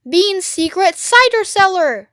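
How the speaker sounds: high, loud announcement; Mister Fox proudly naming the cellar; triumphant. Acted. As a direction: savour it as a prize.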